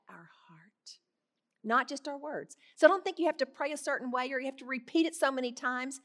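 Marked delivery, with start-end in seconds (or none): 0.1-1.0 he is listening, whispered without voice. Real, not acted. Telling the congregation God hears the heart, whispered. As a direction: lean in and whisper it like a secret.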